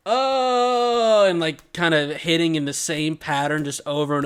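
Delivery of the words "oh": long, loud, high "ohhh"; badly faked cry of falling down the stairs; drawn-out fake fall yell. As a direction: hold one loud raised note. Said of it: "I just start going".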